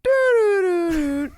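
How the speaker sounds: high, loud electronic doo-doo-doo; imitated toy error sound. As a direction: mimic a toy's slow electronic jingle. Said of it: "And it goes".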